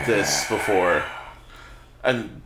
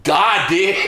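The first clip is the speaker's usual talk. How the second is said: mock-solemn proclamation, pitched up; 4 semitones higher, 4 dB louder than usual. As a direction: imitate a dead-serious catchphrase, barely holding laughter. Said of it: "He was dead serious".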